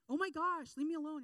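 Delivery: loud, high hammed-up snap; mock overreaction. Classmate snapping back at a helpful friend, hammed-up and loud. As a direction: shriek it like an irritated teen.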